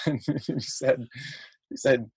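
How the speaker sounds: normal talking voice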